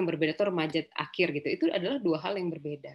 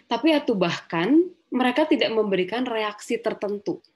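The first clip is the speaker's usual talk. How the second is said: put-on loud, much higher aggrieved teen voice; hurt teenager venting; 4 semitones higher, 5 dB louder than usual. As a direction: spill out the hurt of being ignored. Teen quoted complaining about parents.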